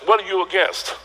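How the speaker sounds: usual speaking voice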